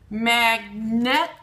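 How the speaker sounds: "Magnet" loud, split as mag, net; sounding out. Shown as a demonstration. Teacher showing the middle sound, "Magnet" punched in two beats. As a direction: hold both halves of "Magnet" apart. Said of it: "in the middle".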